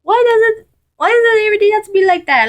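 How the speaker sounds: a shrill rushing mother's voice. Acted. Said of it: "Let's do my mother's voice".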